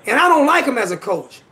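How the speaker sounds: ordinary talk, nothing marked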